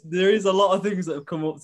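ordinary talk, nothing marked

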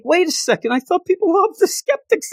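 high-pitched mock upset; upset protest. Host voices skeptics told to go home, upset high protest. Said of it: "They get so upset".

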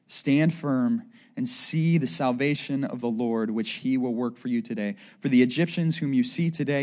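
low, soft, measured reading; scripture reading. Acted. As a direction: read the passage quietly and solemnly. Reads scripture aloud.

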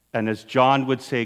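ordinary delivery

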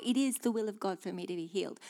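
ordinary delivery

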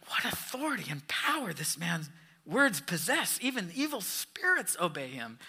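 hushed, breathy wonder; awed whisper. A narrator's reading. Townspeople marveling at Jesus's power, in a whisper. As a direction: whisper it like a crowd in awe. Awed crowd exclaims in story.

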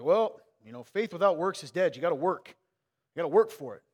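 loud, mocking lecture; mocking preachy church people. Mimicking churchgoers who insist you must earn faith, loudly mocking. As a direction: parody a pushy churchgoer, loudly. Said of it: "people would be like".

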